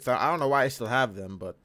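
talking through hiccups; hiccuping between words. Real, not acted. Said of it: "oh, I have the hiccups".

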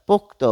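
"পোক্ত" as pok-to, ending in o; two syllables in "পোক্ত", pok-to; vowel added at end. Shown as a demonstration. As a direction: let "পোক্ত" end on an open o. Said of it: "ত এর পরে কিন্তু অ ধ্বনিটি চলে এসেছে".

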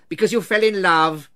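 loud, incredulous repeat; scornful echo. A narrator's reading. Scrooge echoing his nephew's reason, loud scorn. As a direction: throw it back with loud disgust.

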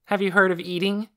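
high, loud mocking question; teasing. A narrator's reading. Boy teasing sleepy Lena at dinner, playful mock. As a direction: needle her with a teasing jab. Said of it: "and he laughed".

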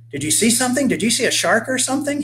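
put-on high, eager boy's questions; excited. A narrator's reading. Boy to his mother about a shark, excitedly. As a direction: ask it all excitedly, pitched right up. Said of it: "he panted excitedly".

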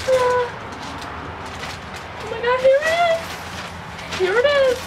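high squeal of delight; squealing with excitement; excited. Real, not acted. Package arrives, squealing excitedly.

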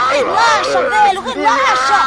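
shouting. Acted. Calming a raving father.

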